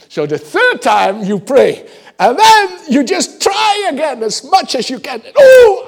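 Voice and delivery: high, loud crying shout; straining cry. Acted. Preacher acting out the third desperate prayer, crying shout. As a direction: push it out like a crushing weight.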